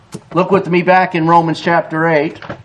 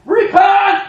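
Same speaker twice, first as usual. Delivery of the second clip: high, loud cry of repent; street preaching shout; 13 semitones higher than usual. Retold or imitated. Preacher shouting to the street crowd, loud cry. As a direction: shout it as loud as you can. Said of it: "as loud as I can".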